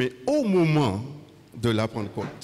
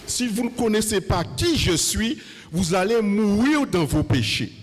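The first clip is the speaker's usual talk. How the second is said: fiery preaching; 7 semitones higher than usual. Acted. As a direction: preach it out with full force. Preacher at full force.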